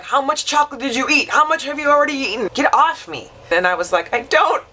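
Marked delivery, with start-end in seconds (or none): 0.0-3.3 higher, frantic retold questions; mock panic. Retelling the family's panic over chocolate. 4.1-4.7 high squeaky words breaking into a laugh. Real, not acted. Caught out, dissolves into laughter.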